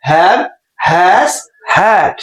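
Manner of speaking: HAVE, HAS, HAD each with a stretched A; long A sound. Shown as a demonstration. Teacher drilling students, each vowel drawn out. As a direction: hold each vowel long, one word at a time. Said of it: "long এ sound হবে".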